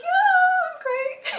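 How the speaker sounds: very high, loud, overdone cheeriness; fake okay. Answering his family while near tears, falsely cheery squeal. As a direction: squeal it as if all is great.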